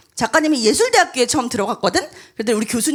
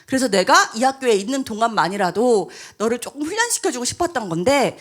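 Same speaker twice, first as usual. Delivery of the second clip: ordinary delivery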